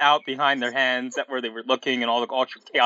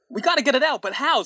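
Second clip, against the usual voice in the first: mock scream; 9 semitones higher, faster than usual. A narrator's reading. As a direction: voice a panicked cry, half screamed. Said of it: "screamed Amy".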